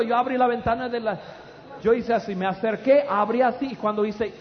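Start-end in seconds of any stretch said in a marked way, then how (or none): none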